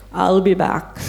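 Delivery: light movie-robot catchphrase voice; a playful nod to the action hero's line; Arnold Schwarzenegger. Retold or imitated. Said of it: "He loved Arnold Schwarzenegger".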